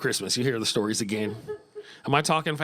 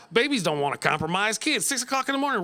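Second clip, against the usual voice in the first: normal talking voice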